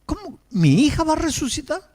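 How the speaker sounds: very high, astonished rising question; stunned question. Retold or imitated. Father hearing his daughter may rise, astonished. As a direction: gasp out the disbelief. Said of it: "el hombre la miró y dice".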